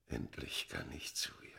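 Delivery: low whispered yearning; longing whisper. Acted. The man speaking of his beloved, low whisper. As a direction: whisper it like a vow.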